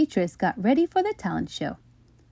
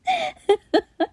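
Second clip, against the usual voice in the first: put-on girlish laugh. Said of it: "the chuckle became a laugh".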